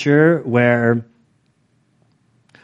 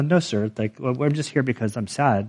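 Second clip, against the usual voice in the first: softer, lower, subdued reply; meek English-speaking American; 3 semitones lower, 7 dB quieter than usual. Retold or imitated. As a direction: shrink into a timid, polite answer. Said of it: "an English-speaking Amer".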